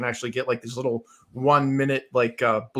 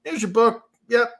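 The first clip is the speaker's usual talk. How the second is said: higher, offhand handover; dismissive author; 9 semitones higher, 5 dB louder than usual. Retold or imitated. Mock author handing over the book, pitched up. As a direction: toss it off like a bored author.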